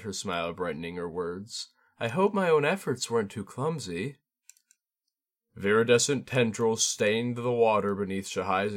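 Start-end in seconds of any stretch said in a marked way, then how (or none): 2.0-4.2 lightly voiced courtly young woman, higher; a hint of a gracious court lady; Shizue's voice. A narrator's reading. Shizue answering Shahai, politely and lightly higher.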